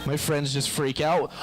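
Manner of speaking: usual speaking voice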